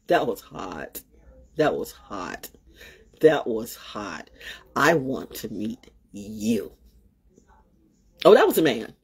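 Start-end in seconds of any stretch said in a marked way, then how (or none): 0.1-6.7 low, soft and slow, savouring each word; sultry swoon. Real, not acted. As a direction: melt over him in a low purr. Swooning over helicopter pilot.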